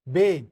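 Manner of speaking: बे as a firm "beh"; correct German B. Shown as a demonstration. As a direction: repeat बे emphatically as "beh". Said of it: "इसको बोलेंगे बे".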